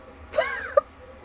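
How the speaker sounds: high-pitched burst of laughter; helpless laugh. Real, not acted. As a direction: crack up, unable to hold it in. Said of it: "I laugh".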